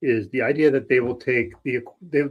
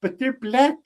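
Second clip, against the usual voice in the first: higher-pitched imitation of a tiny Italian guy; Joe Pesci; 9 semitones higher than usual. Co-host retelling a film's repeated line, high mimicry. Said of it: "hearing this tiny Italian guy being like".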